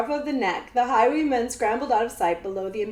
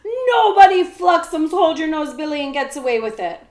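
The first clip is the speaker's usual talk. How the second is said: high, loud warning; loud threat; 6 semitones higher, 8 dB louder than usual. A narrator's reading. Jemmy warns the outlaw off, loud and defiant. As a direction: fling the warning at them.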